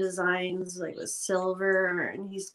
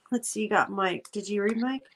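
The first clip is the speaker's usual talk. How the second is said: usual speaking voice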